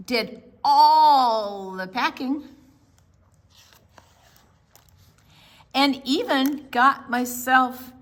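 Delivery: high, loud and impatient; boy hurrying dad to the beach, loud and high; eager child. Acted. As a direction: hurry him out the door.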